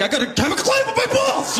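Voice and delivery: high, laughing mock voice of a careless spender; mock rich guy. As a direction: toss it off like a rich guy with no worries.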